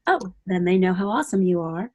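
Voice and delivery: usual speaking voice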